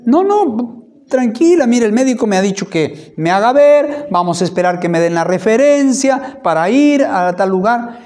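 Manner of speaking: husband telling his worried wife not to rush, high and loud; reassuring insistence. Retold or imitated. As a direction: urge her to relax, loudly.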